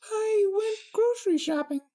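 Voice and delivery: very high, deadpan princess voice. Acted. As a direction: say it flatly in a squeaky princess voice. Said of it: "Twilight deadpanned".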